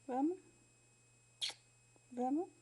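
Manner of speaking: ordinary talk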